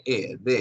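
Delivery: "de" with a short Spanish e, spelled out slowly. Shown as a demonstration. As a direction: sound out "e, de" as the English the before consonants. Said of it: "Pero se pronuncia de, así".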